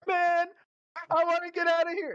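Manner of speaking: high-pitched whiny plea to leave; squeaky lost kid; high whiny Aaron. Retold or imitated. Friend Aaron begging to leave the dungeon, squeaky high voice.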